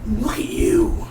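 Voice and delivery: slow, drawn-out mock whisper; mock reproachful whisper. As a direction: whisper it slowly, acting out a reproach. Voices Kilgrave's reproach to Jessica.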